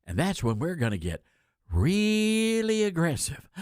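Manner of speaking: put-on speechifying with stretched-out key words; mock a politician's bluster, swelling on "really"; hammed-up imitation of a forceful announcement; mock great gusto. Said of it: "stormed to the microphones and he announced".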